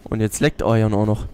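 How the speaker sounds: ordinary delivery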